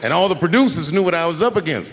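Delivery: ordinary talk, nothing marked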